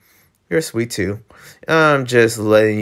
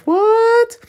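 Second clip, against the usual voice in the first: shocked squeak; 3 dB louder than usual. Acted. Character reacts in shock.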